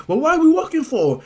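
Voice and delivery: high, loud impatient challenge; acted competitive shout; impatient outburst. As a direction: call it out like you can't wait to race. Impatient rival starts to race.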